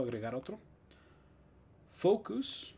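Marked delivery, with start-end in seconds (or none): 1.9-2.7 focus con us final; singular inglés. Shown as a demonstration. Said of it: "este es uno común". (focus en singular)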